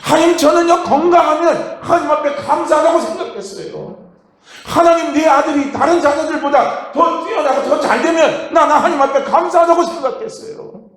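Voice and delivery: confessing prayer. Retold or imitated. Believer retold confessing to God in prayer, fervent. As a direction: cry it out to God as a heartfelt confession. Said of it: "하고 그분이 고백을 한 겁니다".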